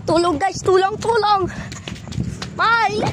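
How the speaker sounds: out of breath calling for help; gasping cries on the run; while running. Real, not acted. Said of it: "Ay, nakakapagod".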